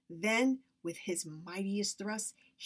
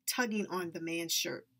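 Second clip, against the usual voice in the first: plain reading voice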